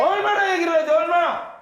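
high loud yell; shout. Shown as a demonstration. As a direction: throw it out loud. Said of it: "repeat louder".

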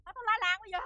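panicked threat. Acted. Threatens to call for help.

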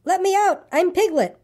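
high-pitched protest, little added volume; crying out. A narrator's reading. Piglet scrubbed in the bath, crying out in protest. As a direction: plead to be freed, pitched right up. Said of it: "cried Piglet".

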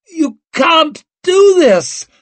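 slow, loud, high-pitched wail; desperate wail. A narrator's reading. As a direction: wail it as you're dragged away. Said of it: "her son wailed".

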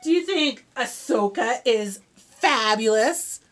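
ordinary delivery

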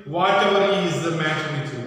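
loud, fast impatient demand; stern demand. Acted. Marilla demanding answers from Anne, stern and loud. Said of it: "Marilla got very angry".

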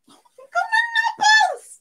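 very high, loud squeal; squealed delight. Pretend. Streamer showing off Nobbles, ecstatic high squeal. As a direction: squeal it right at the top of the voice. Acting out joy over Nobbles.

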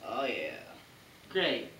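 usual speaking voice

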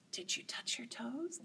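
soft whispered question; whispering. Shown as a demonstration. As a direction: whisper it like a secret.

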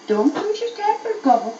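high pleading pixie cry. A narrator's reading. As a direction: call out sharply to the elf in a high voice. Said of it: "cried the pixies".

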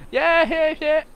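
high, loud imitation of yelling kids; excited little kids. Player imitating little kids at the zoo, high excited yelling. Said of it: "little kids going".

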